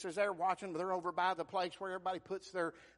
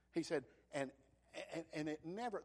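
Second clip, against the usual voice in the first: ordinary delivery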